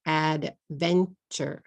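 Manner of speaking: "Adventure" slowly split into three separate beats; syllable by syllable. Shown as a demonstration. Teacher showing the three syllables of "Adventure", said syllable by syllable. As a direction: break "Adventure" apart as Ad, ven, ture. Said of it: "Three syllables".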